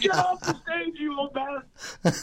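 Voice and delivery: high, slow mocking heckle. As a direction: drag it out like a drunk heckler. Crowd heckling the emcee.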